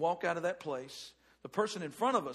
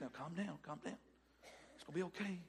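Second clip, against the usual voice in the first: much softer, soothing inner voice; calm yourself down softly; the Holy Spirit hushing an angry shopper, very soft; gently calming; 3 semitones lower, 15 dB quieter, faster than usual. Acted. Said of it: "the Holy Spirit's".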